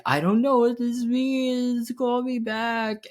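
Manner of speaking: high, slow panicked squeal; freaking out. Retold or imitated. Voicemail to his friend, mock panicked and high. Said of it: "freaking the fuck out".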